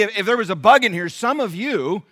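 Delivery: ordinary talk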